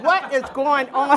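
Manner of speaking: high, loud mock panic through laughter; alarmed question. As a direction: cry out in comic disbelief. Retells her panic as head screams.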